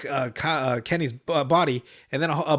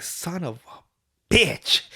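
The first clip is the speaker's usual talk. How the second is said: drawn-out, slow retold curse; mock mad cursing; 2 semitones higher, much slower than usual. Cartoon boy cursing after the bullet hits him, drawn-out mock anger. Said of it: "He's mad as fuck".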